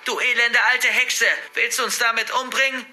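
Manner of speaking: high, loud furious insult; outraged girl yelling back; defiant shout. A narrator's reading. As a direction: hurl it at your attacker. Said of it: "schrie ich".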